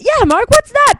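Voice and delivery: snatch the cup, scolding; aunt's loud mock indignation; stern aunt. Aunt scolding over coffee.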